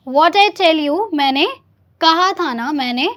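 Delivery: loud, emphatic told-you-so drill; loud practice repeat. Shown as a demonstration. Teacher modelling the phrase for students to repeat, loudly. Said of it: "teacher repeating for practice".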